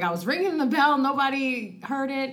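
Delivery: high-pitched retold grievance; retold exasperated complaint. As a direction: replay your exasperation, pitched well up. Retold complaint to coworker at door.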